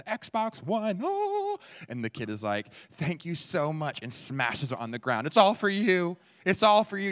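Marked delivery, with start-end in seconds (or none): 0.0-1.6 high, loud squeal of delight; acted excitement soaring up high; thrilled gift squeal. Acting out receiving a Christmas gift. 2.9-4.0 put-on high, loud, drawn-out kid's thanks; over-the-top acted gratitude, slowed down; gushing thanks. Kid thanking parent for gift. 5.3-7.1 high, very loud mock-devoted yell; over-the-top acted shouting; exultant shout. Kid smashes gift, shouting it.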